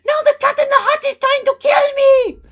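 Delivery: furious, high-pitched scream; very loud, squeaky character shout; Gru. A narrator's reading. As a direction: scream with fury in a cartoon accent. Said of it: "Gru screamed".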